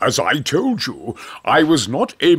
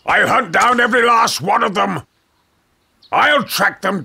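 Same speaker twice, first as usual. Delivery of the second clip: furious shout; 7 semitones higher, faster than usual. Acted. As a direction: bellow the threat like a ruthless hunter. Hunter vows to kill gorillas.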